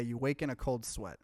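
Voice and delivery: normal talking voice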